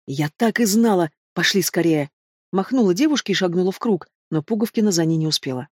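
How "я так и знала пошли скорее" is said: ordinary delivery, nothing marked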